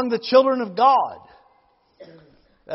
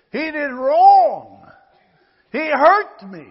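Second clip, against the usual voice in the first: louder, higher, slowly stressed; aggrieved outburst; wounded complaint; 5 semitones higher, 9 dB louder, slower than usual. Acted. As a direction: press the hurt of a wronged brother. Voicing the wronged brother.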